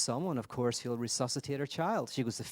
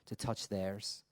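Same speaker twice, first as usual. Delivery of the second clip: normal talking voice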